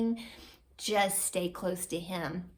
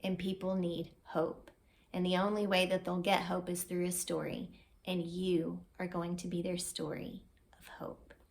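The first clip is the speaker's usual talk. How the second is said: much softer, ending in a whisper; tender, gentle reassurance; God's calm, soft voice, fading to a whisper; calm and sweet; 5 dB quieter than usual. Retold or imitated. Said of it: "very sweetly, very calmly said".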